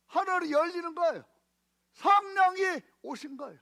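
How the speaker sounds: high, loud preaching climax; preacher building to a fervent climax. Acted. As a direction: proclaim it at full force.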